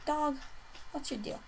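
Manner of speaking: usual speaking voice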